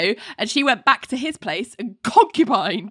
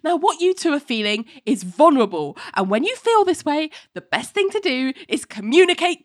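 firm counsellor; 7 semitones higher than usual. Retold or imitated. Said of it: "is like".

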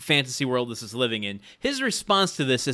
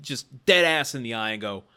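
usual speaking voice

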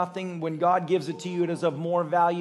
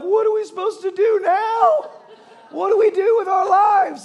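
put-on high, loud bewildered kids; high, laughing what do we do; awestruck kids; 13 semitones higher, 6 dB louder than usual. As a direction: play stunned boys asking what now. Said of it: "they're like".

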